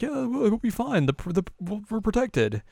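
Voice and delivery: high, breezy brush-off; high dismissive priest. Retold or imitated. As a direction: wave the worry away, up high. Voicing the careless priest.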